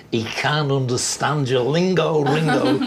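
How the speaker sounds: put-on a light Liverpool accent, half-sung with a laugh; lilting Liverpool sound on the lyric. Retelling the Beatles song in a light Liverpool accent. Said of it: "tried to go into a Liverpool accent".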